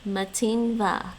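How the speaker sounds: Matin va drawn out slowly with the stress on Matin; slow careful repeat. Shown as a demonstration. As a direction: spell out Matin va slowly to catch it. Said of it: "He says it really fast".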